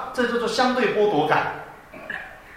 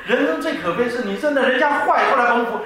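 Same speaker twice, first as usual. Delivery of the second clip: usual speaking voice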